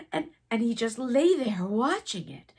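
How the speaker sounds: plain reading voice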